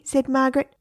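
ordinary delivery

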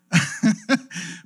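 short breathy laugh at himself; embarrassed little chuckle; sheepish laugh. Real, not acted. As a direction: laugh off the confession. Laughs at own admission.

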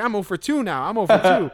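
high, loud, chipper self-consoling; mock upbeat analyst. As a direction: cheer yourself up like a batter who went hitless. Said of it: "He's like".

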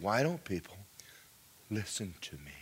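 a grieving, sinking question; weeping. Retold or imitated. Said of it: "And he was weeping".

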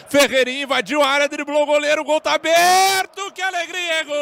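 excited goal call. Real, not acted. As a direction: race up to the goal. Fast rising goal build-up.

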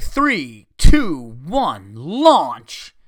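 loud mock launch countdown, spaced out; called-out countdown. Slide operator about to drop the rider, calling out the countdown. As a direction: punch each count and lift the final command.

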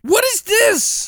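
very high, drawn-out cry of disbelief; exasperated high-pitched bewilderment; incredulous. Real, not acted. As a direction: stretch it out in a baffled squeal. Baffled reaction to bizarre film character.

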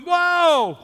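high, drawn-out "whoa"; startled whoa. Retold or imitated. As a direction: imitate a crowd's startled reaction. Crowd's reaction to a fire.

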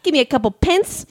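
high, hammed-up trashy British; trashy British accent. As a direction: put on a high-pitched British street accent. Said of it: "in your trashy, uh, British".

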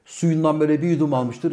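ordinary talk, nothing marked

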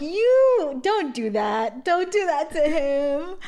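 loud, high, drawn-out protest; mock scolding. Viewer scolds Rafiki for raising Simba's hopes, mock outrage. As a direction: scold in exaggerated dismay, stretching the words.

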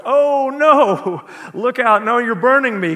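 panicked ghost. Acted. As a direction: recoil from the angel with a frightened yelp. Ghost burned by approaching angel.

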